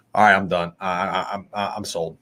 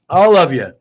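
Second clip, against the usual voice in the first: usual speaking voice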